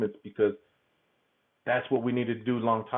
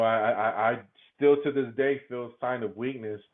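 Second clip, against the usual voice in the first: ordinary delivery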